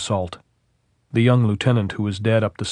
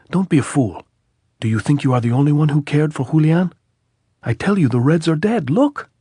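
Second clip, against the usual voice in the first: high, loud scolding; captain scolding the lieutenant, loud; angry berating; 7 semitones higher, 5 dB louder than usual. A narrator's reading. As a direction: rebuke him, rising to a yell on look.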